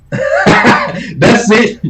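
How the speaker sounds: high laughing burst. Real, not acted. Amused by the Spanish father claim, laughing high. Laughing at her own quote.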